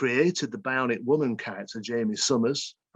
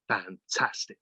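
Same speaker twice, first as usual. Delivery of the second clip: Christopher Eccleston's fantastic; 3 semitones lower, 4 dB quieter than usual. Retold or imitated. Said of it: "the way Christopher Eccleston does".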